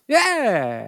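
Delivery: put-on high, loud drawn-out baby babble; baby's yeah. Imitating the baby's attempt at a word, high, loud babble. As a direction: imitate a baby's big, falling first word. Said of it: "I mean, he goes".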